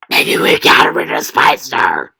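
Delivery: deep, loud demonic growl. Acted. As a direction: snarl it like a demon. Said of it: "I would like to apologize about using my demon voice".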